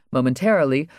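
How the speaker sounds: ordinary delivery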